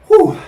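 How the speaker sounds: high breathy whew from the heat; sharp heat whew. Real, not acted. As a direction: blow out the burn. Exhale after hot bite.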